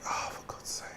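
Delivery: whispered, barely audible grumble; exasperated whisper. Real, not acted. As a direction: trail off into a whisper. Whispers exasperated thought to self.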